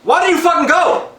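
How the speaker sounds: high-pitched, incredulous demand; exasperated yelling. Pretend. Viewer berating the movie husband, exasperated high yell. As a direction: scold with the pitch thrown right up.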